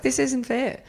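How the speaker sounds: high-pitched sulky protest; retold unfairness whine, voice high; mock whining. Retold complaint about boyfriend.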